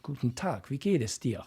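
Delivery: Guten Tag, wie geht es dir with German resonance and R's; native German. Shown as a demonstration. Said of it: "Instead of".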